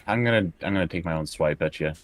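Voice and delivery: ordinary talk, nothing marked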